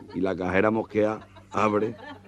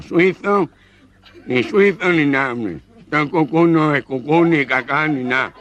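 nasal voice; 4 semitones higher, slower than usual. Acted. Nasal-voiced customer complaining.

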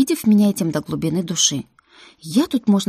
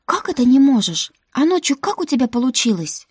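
higher, louder indignant outburst; panicked outcry; 7 semitones higher, 5 dB louder than usual. Acted. Heroine panics and scolds her guardian, loudly. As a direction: demand it in a panic.

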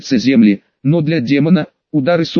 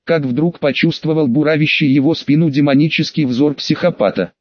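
ordinary delivery, nothing marked

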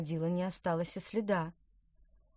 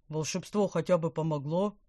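gurgling fish; 5 dB louder than usual. Acted. Calmed fish asks.

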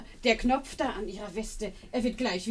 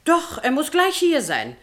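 eager insisting; 7 semitones higher, 8 dB louder, slower than usual. Acted. Housekeeper eagerly keeps visitor.

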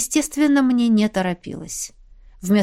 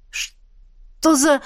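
startled; 6 semitones higher than usual. Acted. Waking chained, startled exclamation.

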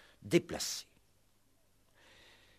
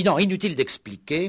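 cutting in; 8 semitones higher, 8 dB louder than usual. Acted. Inspector cuts off suspect.

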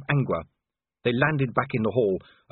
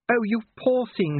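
slow, high, pitying lady's voice; Sybil; 12 semitones higher than usual. A narrator's reading. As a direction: coo with sympathy over someone hurt. Sybil comforts bleeding Angua.

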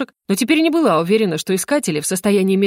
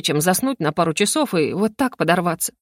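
ordinary delivery, nothing marked

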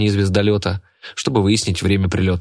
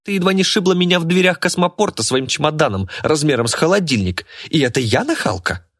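put-on indignant spoiled girl; 8 semitones higher than usual. Martina retorts to old woman.

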